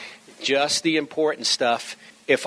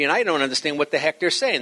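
ordinary talk

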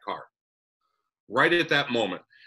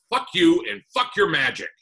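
loud, higher snap of fury; angry outburst; 8 semitones higher, 6 dB louder than usual. Retold or imitated. Husband curses his wife's magic, loud and furious. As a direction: hurl it at her in a rage.

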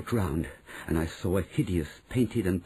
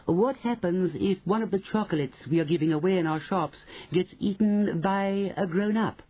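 witch in the audience; 9 semitones higher than usual. Acted. Witch questions Her Grandness, high and deferential. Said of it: "said a voice in the audience".

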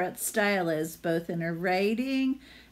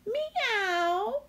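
much higher meow called down; cat meow. Acted. Barn cat calls down from the tree to Hedgie, high meow. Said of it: "he called down".

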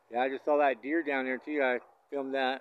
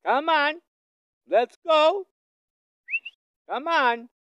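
very high, loud call to the bull; 11 dB louder, much slower than usual. Real, not acted. Rancher calling the bull from afar, high and loud. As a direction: sing it out high to draw him over.

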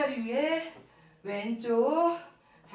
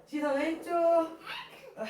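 ordinary talk, nothing marked

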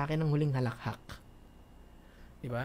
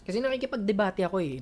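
ordinary talk, nothing marked

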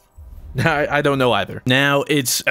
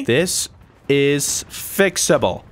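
very slow, spaced-out words; slow pep talk; much slower than usual. Real, not acted. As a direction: spell it out slowly to rally the team. Rallying the team with a slogan.

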